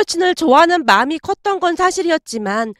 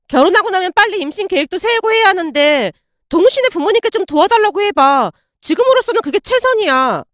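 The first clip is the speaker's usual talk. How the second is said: put-on higher, coaxing girlfriend voice; voiced girlfriend; 6 semitones higher than usual. Ex-girlfriend hinting that his parents should pay, higher coaxing voice. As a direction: ease into the ask in a lifted female voice.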